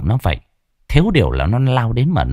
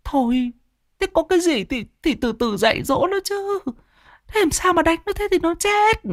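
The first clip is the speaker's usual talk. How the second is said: very high, softer woman's voice, pleading; pleading mother; 3 dB quieter than usual. Acted. Mother pleads with her husband to stop, high soft woman's voice. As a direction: gently beg him to stop.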